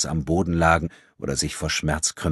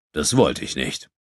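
feigned regret; 3 semitones lower than usual. A narrator's reading. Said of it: "mit geheucheltem Bedauern".

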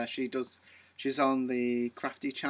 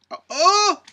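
high, loud "oh!" of remembering; gasped, high-pitched exclamation; sudden excited realization; 12 dB louder than usual. Real, not acted. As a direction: burst out as an idea strikes.

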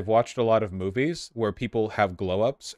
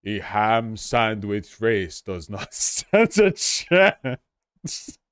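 Measurehead impression; much slower than usual. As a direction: draw it out like a solemn pundit. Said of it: "I'm just doing Measurehead".

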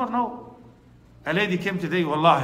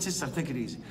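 soft, much quieter Sister, take it easy; gentle comforting; 5 semitones lower, 12 dB quieter, faster than usual. Retold or imitated. Imam softly comforting the crying mother. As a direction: soothe her gently, almost hushed.

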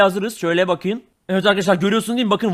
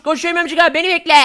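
put-on high, eager little kid voice; high kid sidekick begging to be waited for; 9 semitones higher than usual. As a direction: squeak it like a small scared boy.

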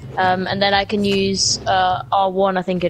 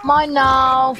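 villain voice; 5 semitones higher, 4 dB louder than usual. Acted. Villainous gloat.

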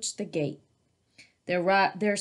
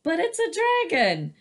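1 protesting cub; 10 semitones higher, 4 dB louder than usual. A narrator's reading. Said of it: "the cub said".

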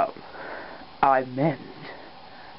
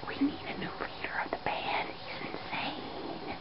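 hushed breath-only whisper; secret aside; 8 semitones higher, 16 dB quieter than usual. Acted. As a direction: whisper it behind the leader's back. Aside about the crazy leader.

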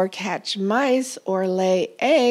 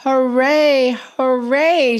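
higher, louder cheer; 5 semitones higher, 7 dB louder, slower than usual. A narrator's reading. The whole farm cheering for Titus. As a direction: cheer with joy. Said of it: "cheered the farmer".

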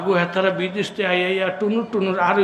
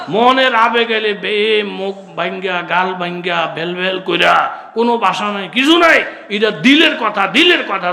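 raised, loud, stretched-out preacher's delivery; impassioned preaching; 5 semitones higher, 6 dB louder than usual. Real, not acted. Preacher urging prayer straight from the heart, impassioned. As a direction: swell with fervour, drawing words out.

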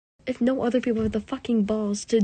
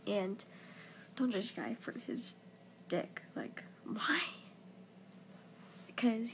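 soft, breathy whispered confiding to camera; hushed whisper; 11 dB quieter than usual. Real, not acted. Talking to girls watching, whispering so nobody hears. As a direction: keep it hushed, late at night.

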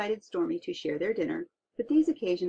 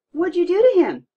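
much higher and louder amazed cry; 11 semitones higher, 11 dB louder than usual. Acted. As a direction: sailors shout in wonder. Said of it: "the others cried".

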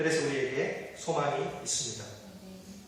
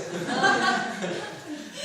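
1 breathy laugh; 5 semitones higher than usual. Real, not acted. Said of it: "제가 무슨 노래 부르는지".

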